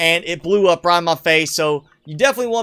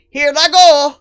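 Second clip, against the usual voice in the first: high, loud plumber whoop; Mario-style catchphrase; 8 semitones higher, 10 dB louder than usual. Acted. Gamer playing Mario spots a way on, high excited call. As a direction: call it out like a game hero leaping.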